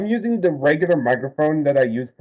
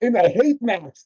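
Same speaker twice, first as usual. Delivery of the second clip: slow, higher mocking quote; mocking Tonio; 7 semitones higher, much slower than usual. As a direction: mimic him sneering, lightly. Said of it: "That's pretty much what he said".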